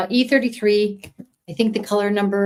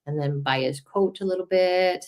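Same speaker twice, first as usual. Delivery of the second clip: ordinary delivery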